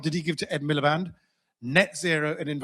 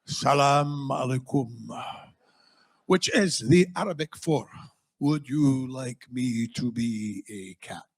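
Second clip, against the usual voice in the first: Galloway impression; 4 semitones lower, slower than usual.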